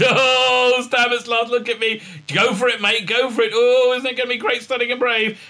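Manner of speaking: high, gushing parody voice; mock gushing. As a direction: camp it up, sugary sweet. Mocking Saru's gushing voice.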